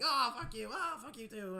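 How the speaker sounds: playful shouted insult at the bus driver; mock yelling match. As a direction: hurl it like a friendly daily fight.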